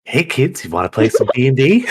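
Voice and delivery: put-on high creepy sing-song to kids; a creepy man luring kids; creepy serial killer voice. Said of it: "Do it".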